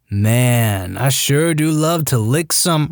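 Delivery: slow slurred half-asleep drawl; drugged slur. Retold or imitated. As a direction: drag the words out, sedated and sloppy. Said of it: "slurs out".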